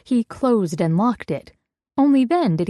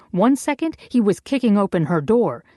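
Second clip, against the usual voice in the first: usual speaking voice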